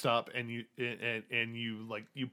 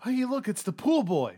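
high, loud schoolyard jeer; high bully taunt; 12 semitones higher, 6 dB louder than usual. Acted. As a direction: shout it like a bully mocking a nerd. Mocking bully taunt in scene.

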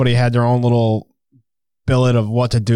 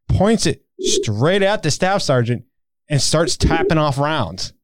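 ordinary talk

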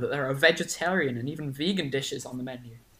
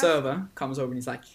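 ordinary talk, nothing marked

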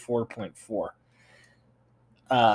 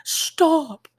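high, loud mock cry; imitated girl's yell; 8 dB louder than usual. Host mimics the movie's little girl, mock shout. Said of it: "that little girl was like".

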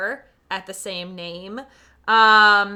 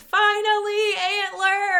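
excited; 9 semitones higher, slower than usual. Real, not acted. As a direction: cheer the title out.

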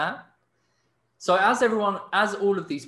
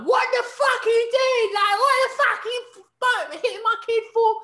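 much higher, louder screaming rage; furious mum; 6 dB louder than usual. Retold or imitated. Mum on the tube screaming at the businessman, shrill furious yelling. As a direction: scream at the man who hit your kid. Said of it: "she starts going".

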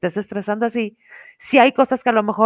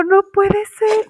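higher, slow, dramatic disbelief; mock despair; 11 semitones higher, 4 dB louder, much slower than usual. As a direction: stretch it out like the end of the world. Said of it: "Y yo".

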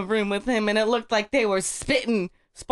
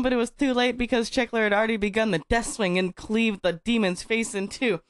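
ordinary delivery

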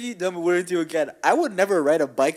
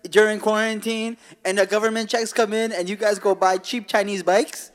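loud, higher-pitched hype pitchman; mock radio ad; 6 semitones higher, 5 dB louder than usual. Podcaster improvising a fake dirt bike sponsor read, hyped-up ad voice. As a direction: sell cheap bikes like a hard-sell commercial.

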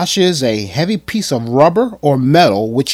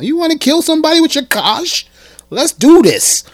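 higher, louder sarcastic welcome; mocking invitation; 4 semitones higher, 4 dB louder than usual. Vlogger taunting Neeson, loud sarcastic invitation. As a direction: taunt him to come on down.

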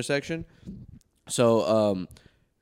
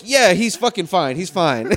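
sarcastic; 5 semitones higher, 5 dB louder, slower than usual. Retold or imitated. Telling cop on phone, sarcastic.

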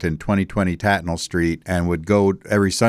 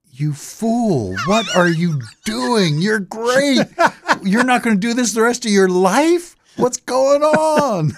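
very high, rising mock disbelief, stretched slow at the end; incredulous scolding; 3 dB louder, slower than usual. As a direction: squeal it out like a horrified older sibling. Scolding brother for quitting music.

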